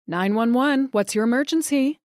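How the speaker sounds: a hint of a female 911 dispatcher; lightly voiced dispatcher. A narrator's reading. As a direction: answer the call like a dispatcher. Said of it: "said the female dispatcher".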